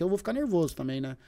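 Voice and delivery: normal talking voice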